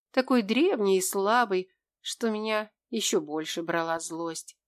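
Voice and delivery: plain reading voice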